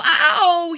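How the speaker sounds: loud, high "ow" groan; loud cry of pain. A narrator's reading. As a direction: yelp and groan from a stubbed toe. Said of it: "he groaned".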